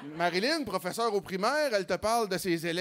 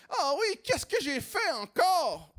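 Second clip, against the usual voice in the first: much higher, slow innocent question; mock innocent girl; 8 semitones higher, slower than usual. Girlfriend asking innocently in a much higher, slow voice. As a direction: ask what you did, wide-eyed and high. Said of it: "Puis là, elle fait".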